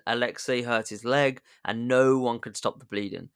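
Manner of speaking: ordinary delivery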